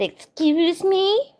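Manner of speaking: high, drawn-out, slow indignant "Excuse me?"; boss mock indignant. Boss to employee, slow high indignant mock. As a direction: mimic the boss's offended snap. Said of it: "And our boss said".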